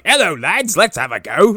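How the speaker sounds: cheery Cockney greeting before a fight; Cockney lad squaring up; Cockney Michael Myers. Acted. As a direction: put on a matey Cockney accent. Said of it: "Michael Myers is just Cockney".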